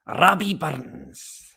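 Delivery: playful Scottish voice for the poet; Scottish accent. Shown as a demonstration. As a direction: lay on a thick Scottish brogue. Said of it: "That's my pure Scottish voice".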